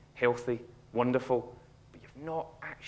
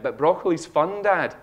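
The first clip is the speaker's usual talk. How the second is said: a light mock child voice, a little higher; little son voice; 6 semitones higher, 7 dB louder, slower than usual. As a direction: play the four-year-old chirping up cheerfully. Son's words at dinner retold.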